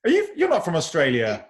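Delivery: real surprise. Real, not acted. Singer learning the fan is Australian, surprised. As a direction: react with delighted disbelief.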